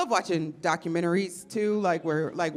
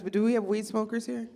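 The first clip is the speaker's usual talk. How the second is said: ordinary delivery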